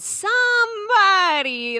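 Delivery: high, loud, slow stretched somebody; drawn-out somebody. Retold or imitated. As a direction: stretch it out in mock disbelief. Retold pitch, stretched word.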